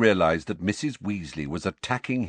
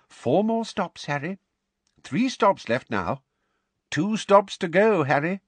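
high, eager countdown, louder at end; anxious counting; 12 semitones higher, 3 dB louder than usual. Acted. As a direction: fret over every stop. Mister Weasley counting stops anxiously.

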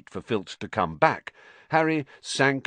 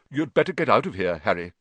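quick warning; 4 dB louder, faster than usual. A narrator's reading. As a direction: warn him in a quick rush. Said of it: "said Nick quickly".

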